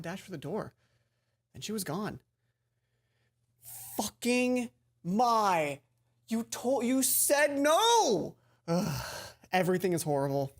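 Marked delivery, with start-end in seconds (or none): 4.0-9.0 loud, high, drawn-out outrage; slow loud disbelieving cry; exasperated outburst. Real, not acted. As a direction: let the betrayal burst out. Outraged at best friend's betrayal.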